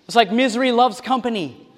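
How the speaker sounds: ordinary talk, nothing marked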